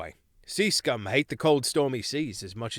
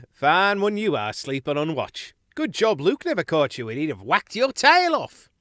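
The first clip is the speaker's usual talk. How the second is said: higher, louder chiding mouse; teasing sailor mouse; 6 semitones higher, 7 dB louder than usual. Acted. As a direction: scold him with a grin. Cole teases sleeping Cordell.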